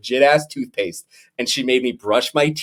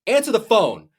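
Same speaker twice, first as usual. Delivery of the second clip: angry demand; 10 semitones higher than usual. Retold or imitated. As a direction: snap it at the silent phone. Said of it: "And I just said".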